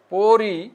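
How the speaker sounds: পড়ি stretched, its final ই held; drawn-out ending. Shown as a demonstration. Teacher showing students how to pronounce it, পড়ি drawn out. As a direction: hold the last vowel of পড়ি long. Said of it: "তোমরা এভাবে টানে উচ্চারণ".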